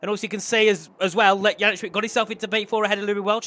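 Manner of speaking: energetic motorsport play-by-play; live race commentary. Real, not acted. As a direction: call the positions with urgency.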